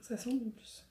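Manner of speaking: soft unvoiced aside; whisper. Real, not acted. Sniffing the product, whispered aside to herself. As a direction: breathe it out as a private aside.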